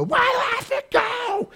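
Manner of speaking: squeaky, high whiny protest; high mock-whiny woman demanding why she must leave; shrill protester voice. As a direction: mimic a whining protester in a shrill falsetto. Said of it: "and the cops say".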